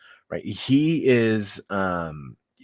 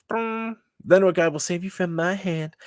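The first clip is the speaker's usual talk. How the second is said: high-pitched taunting king; Nebuchadnezzar; 8 semitones higher than usual. Acted. King daring three captives' god, high-pitched and pompous. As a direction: challenge them in a high, pompous voice. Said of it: "totally how he probably sounds".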